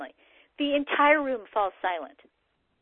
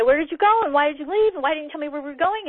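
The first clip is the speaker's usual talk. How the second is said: raised, higher-pitched accusing questions, rushing at the end; angry demand; 4 semitones higher, 4 dB louder than usual. Retold or imitated. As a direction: confront the partner who vanished, fed up. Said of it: "you're pissed".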